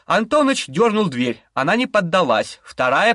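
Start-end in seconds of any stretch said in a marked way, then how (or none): none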